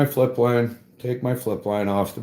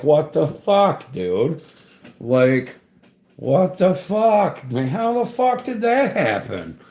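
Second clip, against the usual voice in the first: higher, stunned mock outburst at the snag; what the fuck, rising in disbelief; retold disbelief; 6 semitones higher than usual. Dangling from the rope, to his friend: higher retold disbelief. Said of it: "but I'm like".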